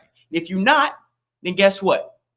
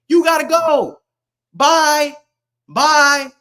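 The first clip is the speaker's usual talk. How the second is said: higher, raised-voice send-off; loud dismissal; 5 semitones higher, 4 dB louder, much slower than usual. Real, not acted. Streamer telling non-citizens to leave, loud dismissal. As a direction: call it out as a taunting goodbye. Dismissing people who don't qualify.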